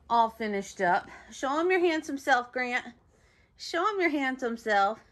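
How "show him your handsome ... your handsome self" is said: high, coaxing doggy voice; pet talk. Real, not acted. Owner showing off freshly groomed dog, high pet talk. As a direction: coax the dog in a lifted pitch.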